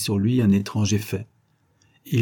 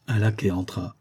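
ordinary delivery, nothing marked

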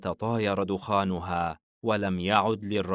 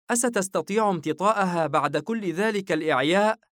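put-on high-pitched child's voice; small boy asking, pitched way up; little boy; 10 semitones higher, 4 dB louder than usual. A narrator's reading. Son asks father about riding after exhaustion, high child voice. Young Ash questions his father.

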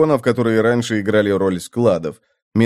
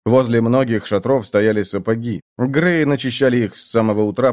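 plain reading voice

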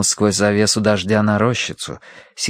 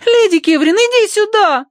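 put-on child calling out; 5 dB louder than usual. A narrator's reading.

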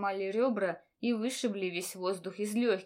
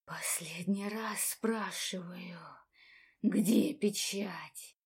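slow, menacing whisper; right in your ear; 2 semitones lower, 3 dB quieter, much slower than usual. A narrator's reading. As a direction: breathe it slowly into her ear. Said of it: "шепча в ухо".

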